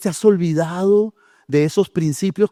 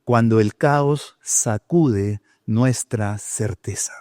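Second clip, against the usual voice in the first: slow emphasis; 8 semitones lower, much slower than usual. Acted. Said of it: "el segundo punto que yo puse es".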